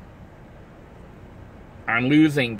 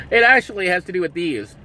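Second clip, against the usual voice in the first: ordinary delivery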